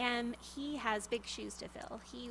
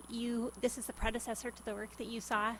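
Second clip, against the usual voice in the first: ordinary talk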